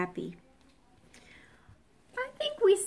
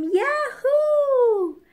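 high, loud, drawn-out whoop; gleeful high scream; whooped yell; 7 dB louder than usual. A narrator's reading. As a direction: let out a long high holler. Parrot's scream in the song.

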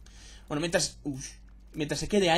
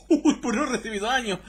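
higher, louder, laughing through it; laughing surprise; 6 semitones higher, 5 dB louder than usual. Real, not acted.

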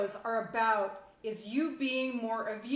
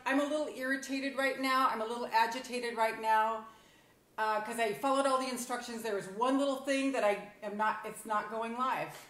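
ordinary talk, nothing marked

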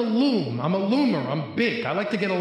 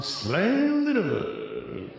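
very slow, drawn out, slammed the door; dad tick repeat; 4 semitones higher, much slower than usual. Shown as a demonstration. Comic dad repeating slammed the door, very slow. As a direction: let the dad tick drag each word out slowly. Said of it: "I got the dad tick going on".

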